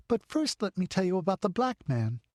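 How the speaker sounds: put-on a girl bursting with news; eager little girl. A narrator's reading.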